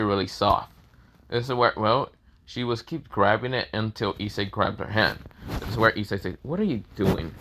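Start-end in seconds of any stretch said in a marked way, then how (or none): none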